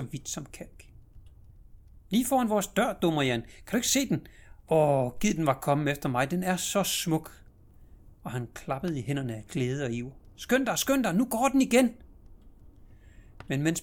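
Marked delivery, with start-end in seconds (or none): 2.1-7.4 put-on high, loud child's longing; boy calling his sister dumb and yearning for the horse; enraptured little boy. 10.4-11.9 very high, loud hurry; boy urging his sister to look quickly; urgent excited child. Acted.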